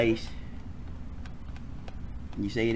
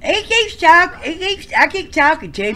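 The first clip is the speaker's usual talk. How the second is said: high whiny voice; 8 dB louder than usual. Acted.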